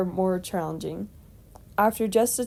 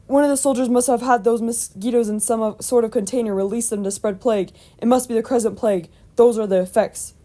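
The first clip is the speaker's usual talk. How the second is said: louder, urgent exclamation; exclaiming; 3 semitones higher, 7 dB louder than usual. A narrator's reading. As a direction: exclaim it loudly as a sudden deduction. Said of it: "exclaimed Williams".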